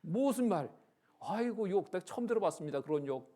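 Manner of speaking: high, loud retold exclamation; mock incredulity. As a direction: act out being taken aback. Acted exchange over her swearing. (어이없어 놀라는 흉내)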